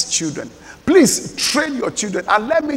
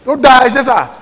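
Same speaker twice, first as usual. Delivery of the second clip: ordinary talk, nothing marked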